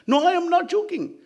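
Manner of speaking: very high, loud denial; insistent protest. Acted. Narada denying to the sage he jokes, high loud protest. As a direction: protest at the top of the voice.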